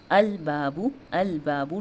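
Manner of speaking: slow "अल बाबू" with a distinct l and long aa; deliberate syllable-by-syllable "अल बाबू"; clear lam. Shown as a demonstration. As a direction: sound out "अल", then stretch "बाबू". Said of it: "लाम का sound clear आ रहा है".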